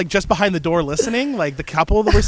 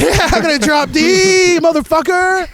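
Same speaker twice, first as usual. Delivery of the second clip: mock metal yell; 10 semitones higher, 5 dB louder, slower than usual. Mock metal threat, laughing.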